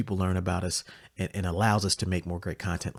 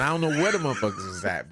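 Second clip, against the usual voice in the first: quick words with a laugh; 7 semitones higher, faster than usual. Real, not acted. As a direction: chuckle through it, fast. Laughs retelling finding push-ups.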